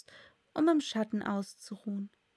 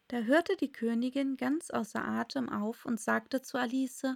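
ordinary delivery, nothing marked

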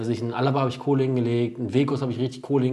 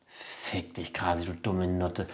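muttered curse; 4 semitones lower, 6 dB quieter, much slower than usual. Retold or imitated. Retold cursing at rival at dawn.

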